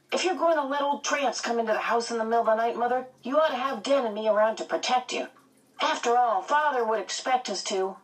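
put-on high, fast boyish voice; cocky young twin. A narrator's reading. Twin boy telling his mother they'll protect her, cocky. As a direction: rattle it off like a bragging kid.

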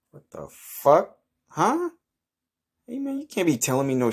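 floored by the news, voice jumps up high; shocked outrage. Acted. Man told he'll have bad luck, shocked and high.

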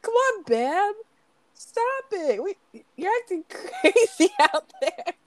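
high, whiny mock protest; drawn-out high plea cracking up; helpless pleading uncle. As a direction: imitate the pestered relative, breaking into laughter. Repeating pestered uncle impression, breaking into laughter.

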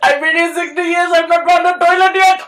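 high, loud imitation of the wail; laugh-crying copy of the old man; mock Indian old man. Friend mimicking the lost old man, loud laughing wail. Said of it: "Why is he Indian?".

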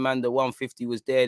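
ordinary delivery

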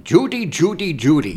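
high, lightly put-on old Hollywood voice doing Cary Grant; a hint of Cary Grant's transatlantic accent; Cary Grant impression. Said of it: "it sounds like Cary Grant".

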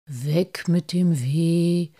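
slow, chant-like healing rhyme; slow, breathy healing charm; murmured incantation. A narrator's reading. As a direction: murmur a soothing spell, drawing out the words. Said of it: "und murmelte".

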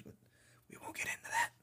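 drops to a secret whisper to skip the tangent; whispered aside. Real, not acted. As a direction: whisper it like a throwaway confession.

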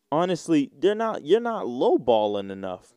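normal talking voice

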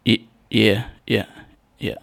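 imitating a distracted, uneasy friend; short, absent yeahs with gaps between; anxious, distracted. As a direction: act lightly on edge mid-conversation. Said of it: "having an anxiety attack".

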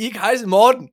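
badly faked German phrase; mangled German words, hammed up; mock German. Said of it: "Jeg kan sige".

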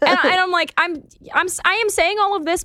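high, bright service-desk politeness; sweet customer service voice. Retold or imitated. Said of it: "in my customer service voice".

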